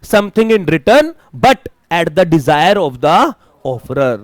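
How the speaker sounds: "Something in return but at the desire of the offerer" spelled out very slowly, word by word; slow dictation. Shown as a demonstration. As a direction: read the definition slowly for notes. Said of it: "consideration का मतलब क्या होता है?". (धीरे-धीरे परिभाषा लिखवाना)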